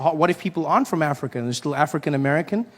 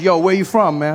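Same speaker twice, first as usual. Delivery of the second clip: brash question; 4 semitones higher, 6 dB louder than usual. Retold or imitated. Comedian asks in story.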